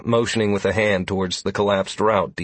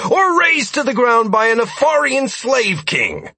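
building higher and louder with each item; heated retort; 13 semitones higher, 5 dB louder than usual. A narrator's reading. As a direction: count off each disaster, rising in anger. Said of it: "I retorted".

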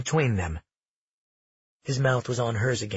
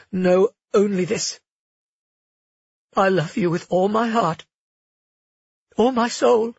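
higher, louder, emotional confession; voice breaking; 7 semitones higher, 4 dB louder than usual. Acted. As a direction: let the voice crack on love. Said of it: "Her voice broke on the raw truth".

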